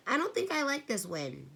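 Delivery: put-on worried girl eyeing the storm; nervous. A narrator's reading. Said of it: "Meg said nervously".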